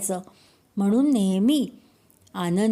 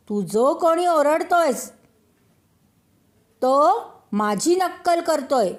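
angry high shout, a bit louder; 7 semitones higher, 4 dB louder than usual. A narrator's reading. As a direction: yell at the unseen mimic in anger. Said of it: "त्या रागातच तो ओरडला".

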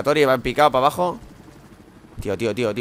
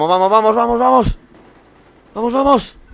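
rising louder and higher each time; excited building cheer; 10 semitones higher, 9 dB louder than usual. Real, not acted. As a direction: build it to a shout.

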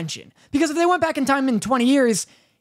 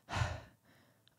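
sighed, quiet; 6 semitones lower, 5 dB quieter than usual. Retold or imitated. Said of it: "and in this, he's like".

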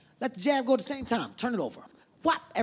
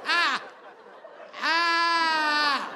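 panicked scream; 6 semitones higher than usual. Retold or imitated. Blinded boxer screaming in the ring, panicked. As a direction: scream like you just went blind.

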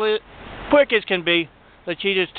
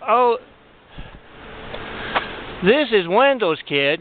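mock innocence; 5 semitones higher than usual. Shoplifter explaining the kids.

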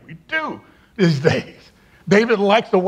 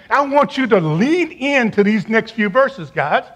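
ordinary delivery

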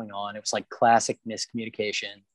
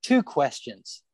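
higher, pleading, half-mock; retold frustrated plea, raised pitch; exasperated plea; 8 semitones higher, slower than usual. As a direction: beg them to throw you a bone. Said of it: "it's just like".

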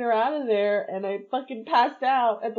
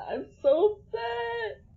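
slow, higher, through tears; crying; 11 semitones higher, slower than usual. Real, not acted. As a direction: speak while really crying. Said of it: "I can't stop crying".

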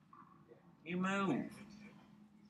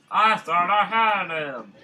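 drawn out louder, stressing I; frustrated slow louder repeat; loud slow lament; 4 semitones higher, 12 dB louder than usual. Real, not acted. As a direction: wail it slowly at the screen.

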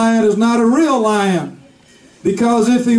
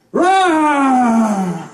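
lion roar. Acted. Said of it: "And he would be like".

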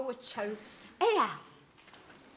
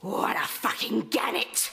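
slow hissed whisper behind her back; muttered aside to the room; 6 semitones lower than usual. Acted. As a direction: breathe the insult out of earshot. Snide aside while daughter's away.

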